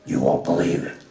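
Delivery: ordinary talk, nothing marked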